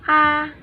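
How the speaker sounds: "A" high and level, first tone. Shown as a demonstration. Teacher showing the high pitch of first tone, level "A". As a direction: sing "A" on one high flat pitch. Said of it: "High pitch".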